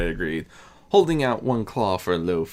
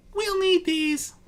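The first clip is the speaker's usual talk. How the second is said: Discord as a boastful youth; slower than usual. Acted. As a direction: brag it like a mischievous young god.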